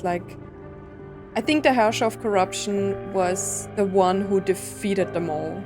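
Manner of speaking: ordinary talk, nothing marked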